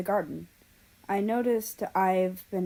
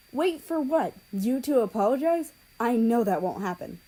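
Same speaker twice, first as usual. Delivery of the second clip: bitter retort; 5 semitones higher, 5 dB louder than usual. Acted. Japan's cold farewell.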